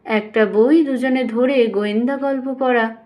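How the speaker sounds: usual speaking voice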